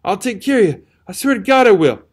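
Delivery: high, loud frantic promise; hysterical cry. A narrator's reading. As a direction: cry it out, near breaking. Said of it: "cried out to him hysterically".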